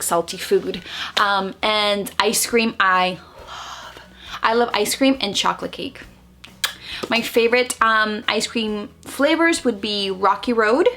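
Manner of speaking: ordinary talk, nothing marked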